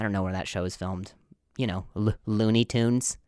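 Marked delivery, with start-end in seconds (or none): none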